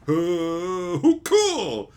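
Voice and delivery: exaggerated wowed reaction; mock easy acceptance. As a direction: play the easily pleased viewer. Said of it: "I can't just go".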